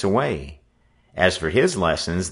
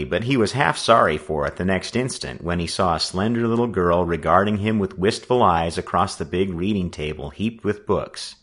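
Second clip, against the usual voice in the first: ordinary delivery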